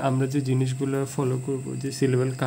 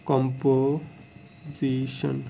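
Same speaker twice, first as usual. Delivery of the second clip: Composition very slowly, broken as কম, পো, জি, শন; syllable by syllable; much slower than usual. Shown as a demonstration. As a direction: draw out and separate the parts of composition. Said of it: "আমরা চারবারে যে ভাঙাইতেছি". (Composition ধীরে ভেঙে ভেঙে বলা)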